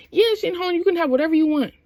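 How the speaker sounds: high-pitched, eager imitation; fawning bartender. Bartender gushing to star customer, fawning. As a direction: pitch it up, mock-obliging.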